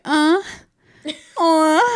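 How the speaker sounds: high, loud Sasquatch whoop; imitated Sasquatch call. Retelling the Bigfoot show, imitated Sasquatch call. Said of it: "he was making Sasquatch sounds like".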